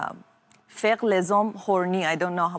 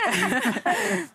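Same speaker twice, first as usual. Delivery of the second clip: short embarrassed laugh; 11 semitones higher than usual. Real, not acted. Embarrassed laugh after compliment.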